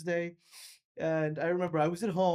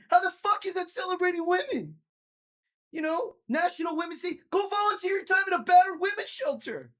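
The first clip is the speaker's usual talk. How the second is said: shouting rant; 9 semitones higher than usual. Real, not acted. Comedian ranting to the crowd about Women's Day, furious shouting. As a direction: yell it out, fed up and furious.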